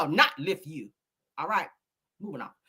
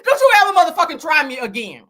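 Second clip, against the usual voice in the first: high, loud scolding; hammed-up furious threat; mock angry warning; 8 semitones higher, 8 dB louder than usual. Acts out cussing someone out.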